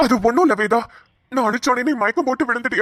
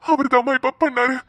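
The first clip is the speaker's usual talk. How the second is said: ordinary delivery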